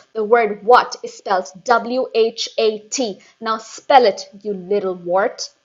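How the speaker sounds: very loud shout. A narrator's reading. Headmistress shouting at Eric held aloft, very loud. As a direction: roar the spelling at him. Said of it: "The Trunchbull was shouting".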